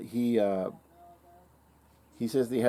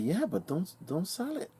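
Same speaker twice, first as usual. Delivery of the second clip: pleading; 9 semitones higher, 5 dB quieter than usual. Retold or imitated. Urging friend to keep rifle.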